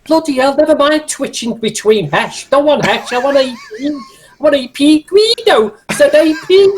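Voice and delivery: high, excited Scouse tank commander; Scouser playing tanks. Scouser ordering his tank crew under fire, agitated role-play. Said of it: "a Scouser plays tanks".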